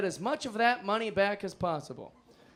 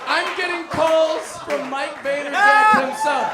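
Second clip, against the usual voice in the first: high, loud and slow, savoring the disbelief; incredulous shout; 8 semitones higher, 5 dB louder, slower than usual. Real, not acted. Comic to audience, stunned shout about the caller. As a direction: yell it like you can't believe it.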